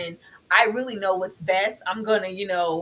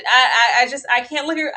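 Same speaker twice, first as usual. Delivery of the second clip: normal talking voice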